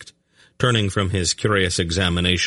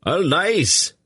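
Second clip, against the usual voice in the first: high, louder scream; 12 semitones higher, 3 dB louder than usual. A narrator's reading. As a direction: scream the denial at them. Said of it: "he screamed at them".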